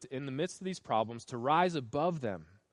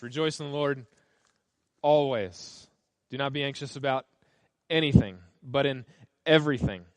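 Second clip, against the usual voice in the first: "always", "anything", "everything" punched much louder; stress on the adverbs; 6 dB louder than usual. Shown as a demonstration. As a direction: hit the words "always" and "everything" hard and loud. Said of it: "What's shocking is the adverbs".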